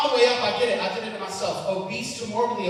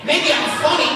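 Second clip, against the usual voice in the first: mock-indignant, raised pitch, loud; indignant outburst; 7 semitones higher, 5 dB louder than usual. Comic defending himself to doubters, rising indignant outburst. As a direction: push the defensive rant toward a yell. Acted retort, building to shouting.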